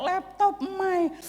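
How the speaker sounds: high-pitched mock panic; retold cry of alarm; imitated panic. Retelling the cry over a lost laptop, imitated panic. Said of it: "ngerti paniknya kayak apa".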